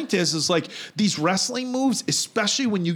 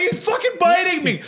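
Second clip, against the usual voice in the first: high urgent yell; telling Tyler mid-fight, high alarmed yell; 13 semitones higher, 3 dB louder than usual. Retold or imitated. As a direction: shout it like the bite still hurts. Telling friend he is bitten.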